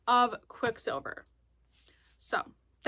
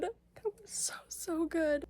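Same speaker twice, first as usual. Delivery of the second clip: breathless whisper; 6 semitones higher, 7 dB quieter, slower than usual. Real, not acted. Overwhelmed after the movie.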